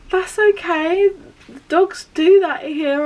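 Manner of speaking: high, loud, slow reassurance; hammed-up consoling of someone in tears; imitated soothing vet. Vet soothes the crying owner.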